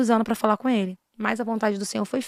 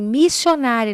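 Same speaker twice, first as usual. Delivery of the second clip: raised-voice summons, retold; loud prophetic call; 3 semitones higher, 7 dB louder, slower than usual. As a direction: imitate a prophet calling out loudly. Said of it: "ainda falava bem alto assim".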